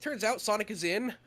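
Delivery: ordinary talk, nothing marked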